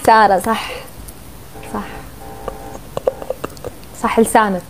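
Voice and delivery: normal talking voice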